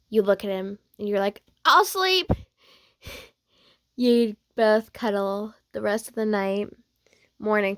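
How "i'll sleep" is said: high, hasty squeak; panicked yielding. Acted. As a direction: give in at once, pitched way up. Hasty yielding to his threat.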